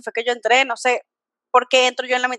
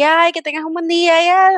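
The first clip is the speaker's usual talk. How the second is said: cheerful Venezuelan greeting; 5 semitones higher than usual. Retold or imitated. Imitating Venezuelans greeting others, cheerful and gushing. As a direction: gush a sing-song good day, imitating cheerful people. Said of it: "Alegre y no sé qué".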